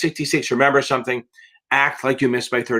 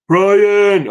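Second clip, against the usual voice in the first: calling out a name; 8 semitones higher, 8 dB louder than usual. Real, not acted. Calling out to a viewer.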